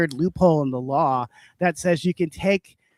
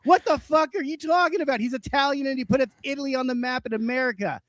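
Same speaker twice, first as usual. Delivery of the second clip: indignant rant; 7 semitones higher than usual. Acted. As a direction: keep the outrage up as the volume settles. Continued rant at the guy.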